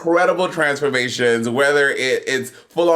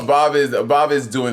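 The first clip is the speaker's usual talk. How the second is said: ordinary talk, nothing marked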